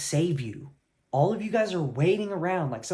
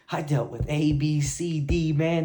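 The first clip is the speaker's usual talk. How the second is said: louder, rattling off the letters; proud excited brag; 5 dB louder, slower than usual. Retold or imitated. Telling a friend about hurdles, proud and excited. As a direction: brag it out, pumped.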